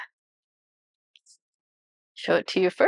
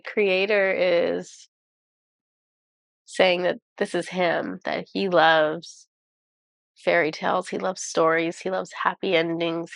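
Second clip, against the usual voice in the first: ordinary delivery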